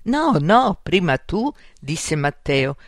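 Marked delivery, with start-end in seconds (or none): 0.0-1.5 loud, high start to a scared protest; acted panicky back-and-forth; frightened insistence. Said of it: "il cuore gli batteva forte".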